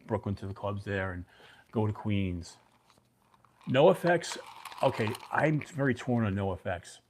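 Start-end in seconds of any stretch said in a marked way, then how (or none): none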